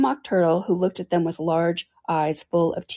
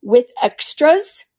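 high, slow, worried question; Mock Turtle anxiously checking Alice's school; a little anxiously; 6 semitones higher, 7 dB louder, much slower than usual. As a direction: ask it nervously. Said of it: "a little anxiously".

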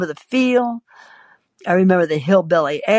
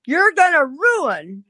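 high, drawn-out fretful complaint; exasperated pleading; 8 semitones higher, slower than usual. Acted. Husband fretting over his Florentine, exasperated. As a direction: draw it out in high-pitched dismay.

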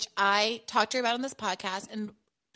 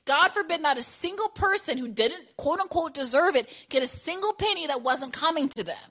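ordinary talk

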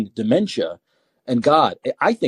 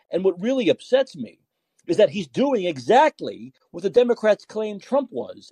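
normal talking voice

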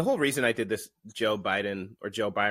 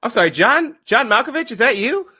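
much higher, louder, rising; mock surprised host; 11 dB louder than usual. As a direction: gush at the surprise guest. Playing surprised host to Malkovich.

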